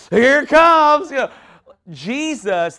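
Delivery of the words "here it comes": higher and louder, erupting; mock preacher outburst. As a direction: burst out like the anointing just hit. Preacher feeling the anointing coming.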